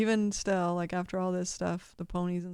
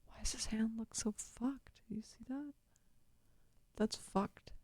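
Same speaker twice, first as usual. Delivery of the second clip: very soft, higher, half-whispered questioning; hushed disbelief; 4 semitones higher, 10 dB quieter than usual. Real, not acted. Baffled aside at the picture.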